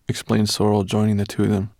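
ordinary delivery, nothing marked

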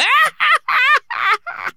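very high, loud screeching laugh; monster laugh. Acted. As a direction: cackle like a mad bird.

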